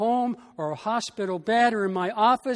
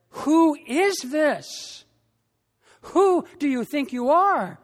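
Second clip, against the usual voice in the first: awed disbelief; 5 semitones higher, slower than usual. Acted. Disciples awed after the calm.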